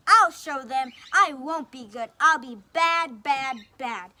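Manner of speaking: loud furious shout; angry shout. Acted. Big Bad Wolf shouting in a temper, loud. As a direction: shout it, stomping off. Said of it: "shouted Big Bad Wolf".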